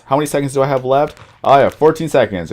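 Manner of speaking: ordinary delivery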